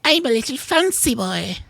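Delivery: put-on high, prissy fancy-boy voice; squeaky high mocking dandy; Tim impression. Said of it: "my impression of Tim".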